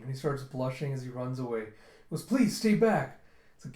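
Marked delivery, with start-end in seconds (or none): 2.2-3.2 high, loud mock panic; panicked plea. Gija running away from Yona, panicked plea. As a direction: beg her urgently to keep away.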